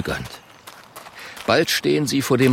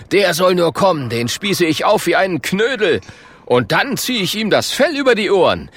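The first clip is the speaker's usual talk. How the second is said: higher, punchy bragging voice; swaggering caveman; 8 semitones higher, 4 dB louder than usual. Acted. Klonk threatens the bear he hasn't seen, swaggering. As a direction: crow about it, pitched up.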